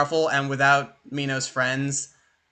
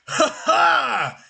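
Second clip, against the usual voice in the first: high, hearty hero's "ha ha"; triumphant laugh; 10 semitones higher, 3 dB louder than usual. Acted. Viking king lands on his feet, triumphant laugh. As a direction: laugh out in victory, pitched high.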